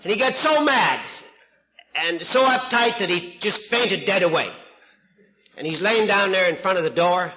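ordinary talk, nothing marked

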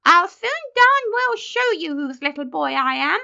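put-on high, loud boyish outburst; indignant retort. A narrator's reading. Benjamin retorting to the colonel, indignantly. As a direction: fire back in an outraged treble.

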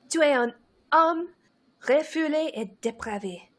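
breathy, seductive French line; sultry French. Acted. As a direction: purr it in French. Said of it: "said Hermione in a sultry voice".

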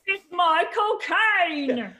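loud, high, stretched-out Cockney; Michael Caine. Shown as a demonstration. Announcing the film star impression, pinched loud Cockney. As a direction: drag it out with a pinched throat. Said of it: "Michael Caine's when I pinch".

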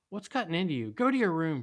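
high, loud scolding mom; yelled. A narrator's reading. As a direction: yell it like an angry mother. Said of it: "she yelled".

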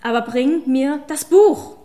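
loud, slow screamed order. A narrator's reading. Witch screams at the man to bring her the book. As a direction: scream the last order at him. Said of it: "Die letzten Worte hatte sie förmlich geschrien".

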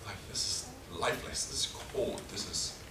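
unvoiced, hushed remembering; hushed whisper. Real, not acted. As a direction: breathe the words over the body. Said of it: "remembering father's body".

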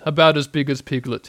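ordinary delivery

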